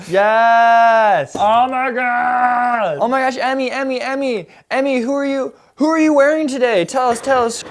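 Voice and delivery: high, loud gushing red carpet host; red carpet interviewer. Pretend. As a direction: gush at the star like an entertainment reporter.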